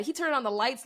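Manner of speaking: ordinary talk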